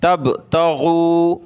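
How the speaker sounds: तगू with a throaty gh, slow; slow tajweed ghain; ghain sound. Shown as a demonstration. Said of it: "गैन पढ़नी है".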